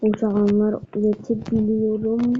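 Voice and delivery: normal talking voice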